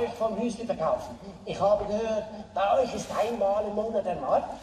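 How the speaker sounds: put-on German with heavy Swiss accent. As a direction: play a jovial Swiss cheese seller. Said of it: "aber ich bin ein Schweizer".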